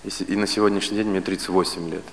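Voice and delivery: normal talking voice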